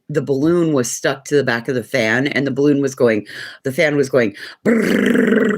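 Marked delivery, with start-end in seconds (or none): 4.6-5.6 high rolled-tongue brrrr; high, louder lip-and-tongue rattle; imitated fan rattle. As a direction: rattle like a balloon caught in a fan. Imitates fan and balloon noise.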